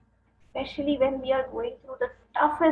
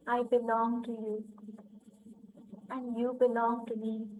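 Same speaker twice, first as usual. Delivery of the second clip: guided meditation; 2 semitones lower, 7 dB quieter than usual. Acted. Leading listeners to affirm oneness with God, softly.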